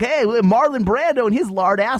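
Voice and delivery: high, hammed-up sales voice; mock promoter. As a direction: pitch it like an over-eager advertiser. Acted promoter selling burial plots.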